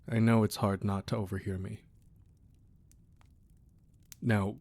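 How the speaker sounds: normal talking voice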